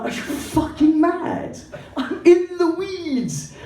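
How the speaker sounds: high, drawn-out wail of disbelief; exasperated protest. Retold or imitated. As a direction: protest in hammed-up, frantic outrage. Acted protest at being called to sing.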